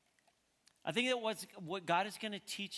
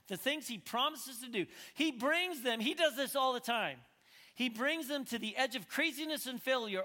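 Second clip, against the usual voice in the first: raised, emphatic pulpit delivery; forceful preaching; 6 semitones higher, 6 dB louder than usual. Real, not acted. Preacher giving the congregation the key quote, forceful preaching. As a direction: drive each phrase home, easing down at the very end.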